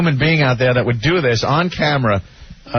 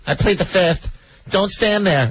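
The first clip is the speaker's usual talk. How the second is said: put-on childish voice; 7 semitones higher than usual. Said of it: "It's like a kid".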